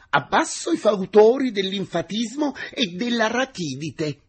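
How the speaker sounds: high, loud mock slogan; same joking tone. A narrator's reading. Teacher answers the engineer's greeting, jokingly shouting. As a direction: fire back a playful, shouted protest. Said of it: "rispose con lo stesso tuono".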